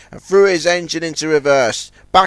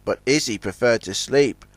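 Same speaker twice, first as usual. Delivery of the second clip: plain reading voice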